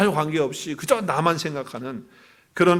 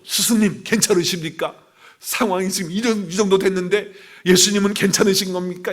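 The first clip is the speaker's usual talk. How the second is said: imagined concerned appeal; 5 semitones higher, 6 dB louder than usual. Acted. Acted concerned disciples addressing master.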